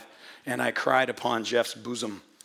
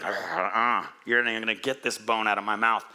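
a lion snarling at a rival over its bone, lowered; growling lion; 6 semitones lower, faster than usual. Retold or imitated. Pastor voicing a lion guarding its bone, low mock growl. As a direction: lower growly lion voice, then a fast warning. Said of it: "the young lions growl over their prey".

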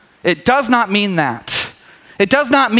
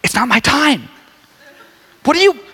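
mock exasperated son; 6 semitones higher than usual. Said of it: "he's like".

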